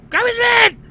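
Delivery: high, loud hammed-up angry yell; comic burst of rage, pitched right up; mad shout. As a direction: yell it like a grump losing his temper.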